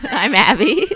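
laughing. Real, not acted. Laughing while introducing herself.

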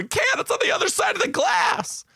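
very high-pitched mock shout; hammed-up high yell of frustrated children; kids' frantic protest. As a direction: imitate a classroom of kids squealing in protest. Kids wailing about unreachable letter.

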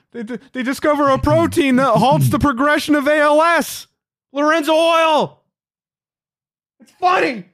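very high, loud, insistent explaining; over-explain the joke, loudly and high; loud lecture to a shushing wife; loud defensive explaining. Retold or imitated. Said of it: "And I'm like".

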